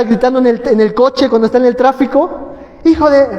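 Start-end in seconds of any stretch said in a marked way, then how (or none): 2.8-3.3 mildly raised, cut-off angry outburst; mock road-rage curse, broken off mid-phrase; angry yell. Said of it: "le está gritando al vecino".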